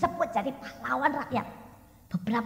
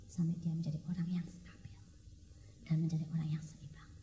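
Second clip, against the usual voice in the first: hushed whisper, much softer and lower; 8 semitones lower, 11 dB quieter than usual. Real, not acted. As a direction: breathe it out as a quiet truth. Sermon, quiet climax.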